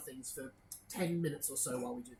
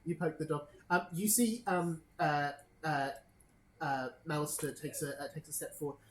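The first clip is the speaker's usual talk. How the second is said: ordinary talk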